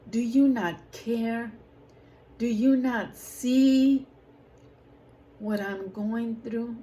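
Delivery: retold desperate cry. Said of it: "crying out to God".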